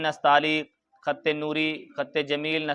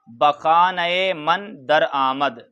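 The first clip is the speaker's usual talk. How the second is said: slow recitation; much slower than usual. Shown as a demonstration. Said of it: "teacher reads Persian line slowly".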